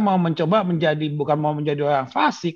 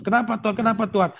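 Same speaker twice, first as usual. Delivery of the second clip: mock protest to God; 7 semitones higher, faster than usual. Voicing people protesting to God.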